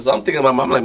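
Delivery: ordinary talk, nothing marked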